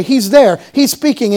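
usual speaking voice

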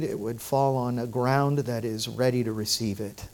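soft, unhurried prayer to God; hushed pastoral prayer. Acted. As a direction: pray it quietly and earnestly. Pastor leads prayer before sermon.

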